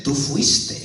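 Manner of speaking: low, soft inner-voice accusation; hushed, low voice of guilt; accusing conscience. Acted. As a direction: accuse quietly, like a nagging conscience. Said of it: "tu conciencia te acusa y te dice".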